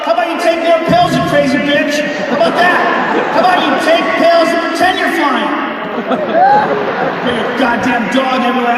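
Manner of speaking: ranting yell. Real, not acted. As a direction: yell it at the crazy passenger. Ranting at dog owner.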